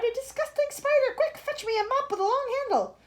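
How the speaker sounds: high, loud disgusted orders; disgusted cry. Acted. Aunt demanding a mop against the spider, high and loud. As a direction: shriek for the mop. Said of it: "she cried".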